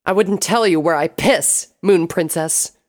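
high, loud, venomous defiance; angry retort. A narrator's reading. Sable refusing to tell Princess Luna, furious. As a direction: throw it in the captor's face. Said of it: "Sable hissed".

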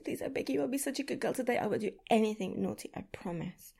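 normal talking voice

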